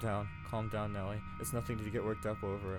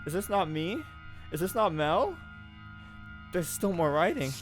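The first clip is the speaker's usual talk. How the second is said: put-on frightened girl questioning the painting; rising panic; 6 semitones higher than usual. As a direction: panic as the truth dawns. Realizes the painting predates her.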